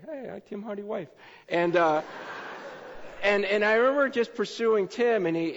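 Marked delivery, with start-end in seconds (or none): 0.1-1.1 light imitation. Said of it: "this girl comes up to me".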